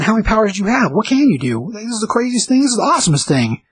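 put-on gushing over the power suit like a kid; super stoked. Friend gushes about the suit, excitedly high. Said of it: "he's super stoked".

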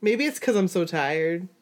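ordinary talk, nothing marked

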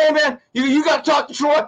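high, loud excited shout as Deion; imitating Deion. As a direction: call out urgently like an upset teammate. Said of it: "Prime was like".

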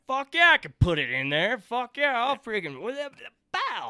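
loud, high, cocky hype; gung-ho acted confidence; excited bravado. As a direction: boast with mounting pitch, ending on a sound effect. Acted bravado on a trout stream.

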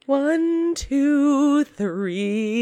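counting. Pretend. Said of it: "a mouse voice".

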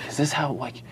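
hushed despair, sinking toward a whisper; muttered self-doubt. Retold or imitated. Comedian rehearsing alone at the mirror, hushed mock despair. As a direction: mutter to yourself, fading almost to breath.

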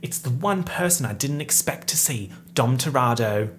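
hammed-up Cockney greeting; Helen Mirren's Cockney. Vlogger mimicking the actress's surprise meeting, hammed-up Cockney accent. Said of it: "distressing Cockney accent".

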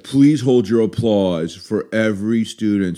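a hint of a stiff ceremony announcer, slow; slow prim announcement, lightly mimicked; mock announcer. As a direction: lightly play the formal graduation announcer. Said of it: "they're always like".

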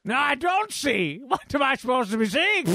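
a high, haughty put-on voice; pompous high voice. Pompous character taunting his rival, high and haughty. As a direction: lord it over him, sneering.

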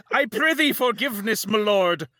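high, slow, hammed-up medieval lament; hammy stage actor. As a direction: wail it like a ham actor on stage. Woe is me, prithee.

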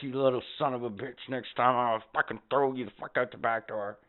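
mock threat muttered low in volume.

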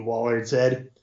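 ordinary delivery

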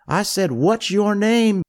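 high, drawn-out East Tennessee demand; East Tennessee accent. Retold or imitated. Principal demanding a student's name, East Tennessee accent. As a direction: imitate an insistent principal with an East Tennessee drawl. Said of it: "in this very drawn out East Tennessee accent".

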